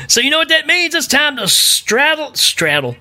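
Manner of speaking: high, excited show intro; hyped announcer. Acted. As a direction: build the hype for the time machine.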